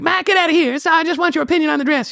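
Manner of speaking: high-pitched cartoon brat voice; Eric Cartman. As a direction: imitate your wife as a whiny cartoon third grader. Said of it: "wife sound like Eric Cartman".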